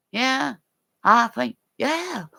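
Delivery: high-pitched voice of listening moms; imitated mamas. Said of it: "and saying".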